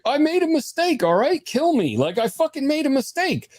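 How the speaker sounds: high, protesting husband; exasperated defensiveness. Retold or imitated. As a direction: imitate a cornered man pleading his case. Husband defends himself against wife.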